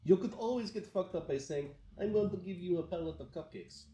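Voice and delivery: high-pitched mock-Russian threat; Russian accent. Shown as a demonstration. Explaining to friend why Russian sounds mean, threatening Russian accent. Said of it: "the accent must sound like".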